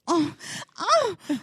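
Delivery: high, breathy-free mock moans of the TV; a sexy TV moan, hammed up high. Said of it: "kinda sexy sounding".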